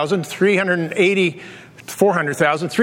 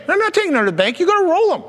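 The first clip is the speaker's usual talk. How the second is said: exasperated; 9 semitones higher, faster than usual. Retold or imitated. Arguing with himself, retold.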